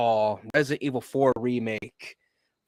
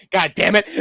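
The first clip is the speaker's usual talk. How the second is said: loud, higher curse breaking into laughter; laughing exasperation; 7 dB louder than usual. Real, not acted. Streamer beaten by the screen share, laughing exasperation. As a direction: curse loudly while cracking up. Cracking up at tech mishap.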